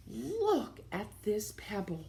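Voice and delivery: hushed, breathy awe over the pebble; whisper. Acted. Father whispering about the pebble he found, hushed. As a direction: whisper it like a secret find. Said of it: "he said".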